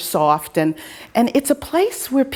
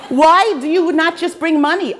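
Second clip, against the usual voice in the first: higher-pitched exasperated mock husband; 6 semitones higher, 3 dB louder than usual. Husband questions wife about using the app, mock exasperated. Husband's acted complaint in story.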